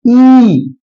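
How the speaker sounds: ई drawn out long and steady; full long ई. Shown as a demonstration. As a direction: hold ई for the full sound. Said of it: "बड़ी ई पे बोलेंगे कैसे". (ई को खींचकर पूरा बोलें)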